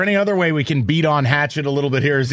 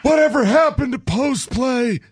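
put-on old man voice; 8 semitones higher than usual. Joins old-man bit, laughing.

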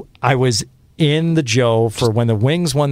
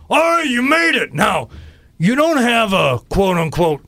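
New Rock Johnny D; 7 semitones higher than usual. Retold or imitated. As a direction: gravelly shout easing into a slower rasp. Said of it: "he had this real gravelly voice".

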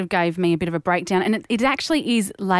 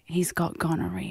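hushed, secretive whisper; 3 semitones lower, 8 dB quieter than usual. Retold or imitated. The mutual friend whispering the bad news to her.

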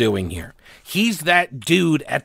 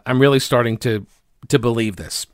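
ordinary talk

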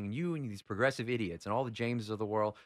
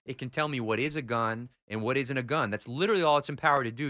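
normal talking voice

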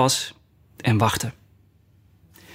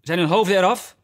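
higher-pitched royal demand; called-out question; 5 semitones higher than usual. A narrator's reading. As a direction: call it out without real shouting. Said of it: "riep de koningin". (vragend uitgeroepen)

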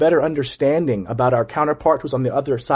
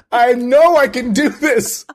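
high-pitched, louder joking self-quote; mock self-assurance; 10 semitones higher, 6 dB louder, slower than usual. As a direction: draw it out high, poking fun at yourself. Joking about failing in marriage.

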